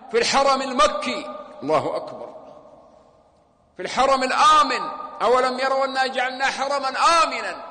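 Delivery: loud and high, outraged; fiery preaching. Real, not acted. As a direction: thunder it to the congregation. Preaching at full force. (خطبة غاضبة بصوت مرتفع)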